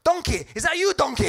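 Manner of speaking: hammed-up Shrek calling for Donkey. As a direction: Gordon Brown as Shrek, loud and high. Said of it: "Gordon's like".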